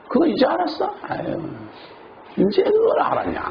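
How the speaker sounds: high sneering put-down of a newcomer; a jaded believer scoffing at a newcomer's excitement, mocking. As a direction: scoff at someone's late discovery. Jaded believer mocks newcomer's joy.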